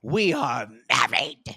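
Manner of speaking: slow, hammed-up big reveal; dramatic announcement. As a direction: announce it like a soap-opera climax. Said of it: "in a very dramatic fashion".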